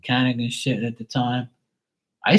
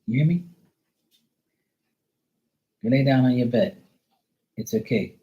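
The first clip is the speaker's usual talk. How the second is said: soothing a scared dog. Real, not acted. Soothing dog after thunder.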